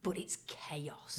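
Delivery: slow, near-whispered verdict; hushed emphasis. Real, not acted. Telling the co-host about the platform scramble, hushed and slow. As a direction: drop to a drawn-out whisper for dramatic weight.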